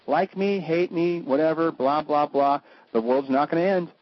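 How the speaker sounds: usual speaking voice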